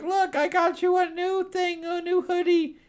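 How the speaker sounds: very high, excited offering; proud gift-giver. Retold or imitated. Dwarf giving the heroes gifts, very high and eager. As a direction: squeak it like a proud little dwarf. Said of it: "he's like".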